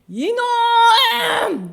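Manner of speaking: long, high, very loud roar of rebuke; thunderous scolding. Acted. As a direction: stretch it into a furious acted shout. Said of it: "하나님께서".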